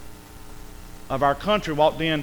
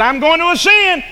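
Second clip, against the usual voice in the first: much higher loud boast; proud Lucifer; 14 semitones higher, 11 dB louder than usual. Acted. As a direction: lift it like a vain rebel.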